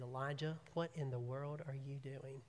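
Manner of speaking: soft and caring, not angry. Acted. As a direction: ask gently with a caring heart. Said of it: "said it with a caring heart".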